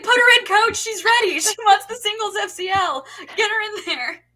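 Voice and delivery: high, loud shouted plea; excited sideline cheering. Retold or imitated. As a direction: yell to the coach like an eager fan.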